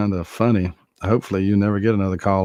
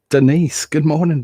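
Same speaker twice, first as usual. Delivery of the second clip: excited greeting; 8 semitones higher, 6 dB louder than usual. Real, not acted. Greeting viewer by name.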